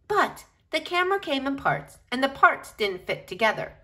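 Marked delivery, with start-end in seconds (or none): none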